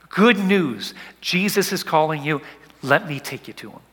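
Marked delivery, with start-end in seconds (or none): none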